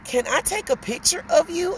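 lightly voiced dentist asking politely; mild imitation of the dentist. Voicing the dentist.

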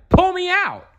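high panicked shout; cry for help. A narrator's reading. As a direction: scream it from the rushing river. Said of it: "Donald cried".

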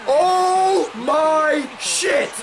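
long gasp, then a slow, stretched oh my shit; stunned gasp. Real, not acted. Gamer stunned by a play, gasping then slow disbelief. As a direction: drag it out in disbelief. Sudden turn in the game.

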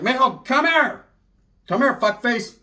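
high, loud, slow call across the room; calling aunt. Retold or imitated. Aunt summoning her nephew, loud high call. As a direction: holler for the nephew like a bossy tia. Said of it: "my tia's like".

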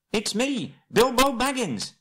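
very high, urgent call; crying out. Acted. Bilbo making himself known to the searching man, high cry. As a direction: cry out your name at the top of your pitch. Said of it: "he cried".

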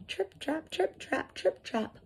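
high, soft trip-trap patter; tiny hooves tapping lightly on wood; little goat hoof steps. A narrator's reading. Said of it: "feet made that sound on the bridge".

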